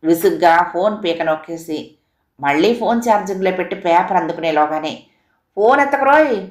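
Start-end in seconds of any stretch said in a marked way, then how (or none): 5.6-6.4 high, loud shouted ringtone; noisy yell. A narrator's reading. Ringtone starting its racket once more, loud yell. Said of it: "అంటూ గోల ఆరంభమైంది".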